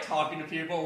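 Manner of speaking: usual speaking voice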